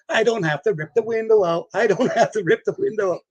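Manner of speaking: high, overjoyed chant, breaking into a laugh; excited cheering. Retold or imitated. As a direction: sing it out like a happy kid. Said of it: "running all over the floor saying".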